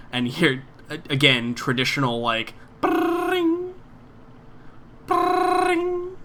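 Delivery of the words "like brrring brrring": high trilled brrring ring; phone ringing noise. Retold or imitated.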